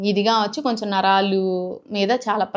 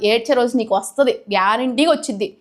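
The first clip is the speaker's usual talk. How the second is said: normal talking voice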